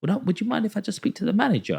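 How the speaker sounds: high, quick, overly polite voice; mock polite request. Customer asking the shop man for the manager, mock polite. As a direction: put on a sugary customer-service politeness, lightly voiced.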